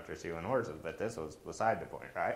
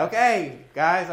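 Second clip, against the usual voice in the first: high, loud announcement; calling out; 10 semitones higher, 11 dB louder, slower than usual. Retold or imitated. Pastor calls guests to dinner, calling out loudly. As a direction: get the whole room's attention, high and loud.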